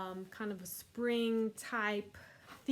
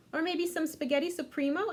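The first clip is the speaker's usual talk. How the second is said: higher eager offer; eager waitress; 7 semitones higher, 3 dB louder than usual. Acted. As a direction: tempt the customer. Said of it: "asked [name]".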